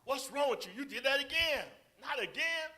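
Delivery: loud, high, scolding outburst; beating someone up verbally. Acted. Acted scolding of someone who made a mistake, harsh. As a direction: act out a harsh telling-off at full volume. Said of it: "beat the person up".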